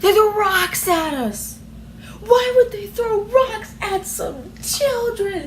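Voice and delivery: can barely get it out for laughing; real laugh-crying. Real, not acted. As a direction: wail it through laughing tears. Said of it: "I can't anymore".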